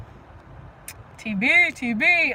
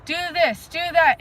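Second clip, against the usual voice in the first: higher, louder barked orders, lightly played; drill sergeant; 6 semitones higher, 4 dB louder, slower than usual. Playing Sarah's drill sergeant, mock barked commands. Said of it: "be your drill sergeant and be like".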